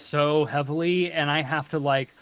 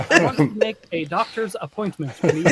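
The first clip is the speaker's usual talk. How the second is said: put-on careful standard American accent; Southern accent switched off. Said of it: "turn that off there for you".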